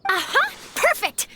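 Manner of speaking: high, gleeful burst of inspiration; delighted eureka. Acted. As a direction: pounce on the idea. Reporter seizes on a story.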